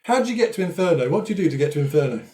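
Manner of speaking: ordinary talk, nothing marked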